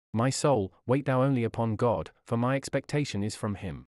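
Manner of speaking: ordinary delivery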